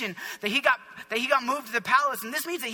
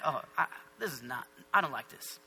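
understated displeasure; 9 semitones lower, 6 dB quieter than usual. Acted. Voicing God's displeasure at the party.

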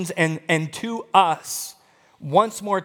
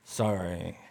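low, quiet, grudging mumble; mock a child's forced apology; sulky kid; 12 semitones lower, 9 dB quieter than usual. Kid made to apologise to a sibling, sulky. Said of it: "And the kids go".

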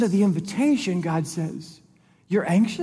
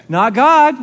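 louder, higher burst; emphatic; 7 semitones higher, 10 dB louder than usual. Real, not acted. Preacher to church, loudly contrasting God with himself. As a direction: throw it out as a firm correction.

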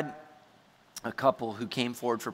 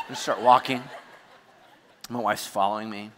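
usual speaking voice

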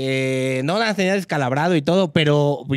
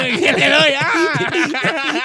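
higher, laughing battle cry; excited punch retelling; 10 semitones higher, 4 dB louder, much slower than usual. Retold or imitated. Acting out his punch for friends, a high laughing yell. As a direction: relive the hit with a laughing yell.

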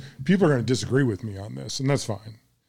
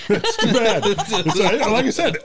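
laughing; 9 semitones higher, 4 dB louder, slower than usual. Real, not acted. Laughing at missing expert.

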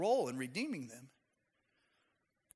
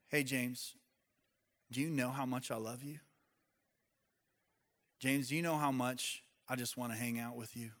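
softer and lower, gently inviting; tender; 6 semitones lower, 8 dB quieter than usual. Pretend. The Holy Spirit gently calling James, soft and tender. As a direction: speak like a loving friend calling his name.

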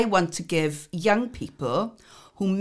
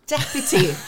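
laughing; 9 semitones higher than usual. Real, not acted. Laughs at own title.

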